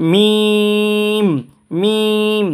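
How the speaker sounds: মিম hummed long through dry closed lips; drawn-out Mim. Shown as a demonstration. Teacher showing students a long Mim. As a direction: hold মিম for three alif lengths. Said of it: "তিন আলিফ টানব".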